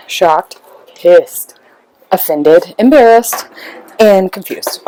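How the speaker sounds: normal talking voice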